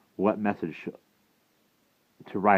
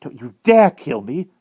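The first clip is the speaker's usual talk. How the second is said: loud, high-pitched protest; defiant cry; 9 semitones higher, 10 dB louder than usual. A narrator's reading. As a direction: shout a desperate warning. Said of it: "cried the wart".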